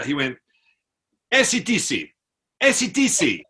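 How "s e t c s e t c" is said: high, loud exclaimed band names; imitating excited fan. Soldier names favourite band to Australian, imitated excitement. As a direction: call out like a thrilled rock fan.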